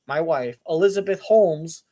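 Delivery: normal talking voice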